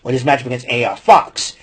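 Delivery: normal talking voice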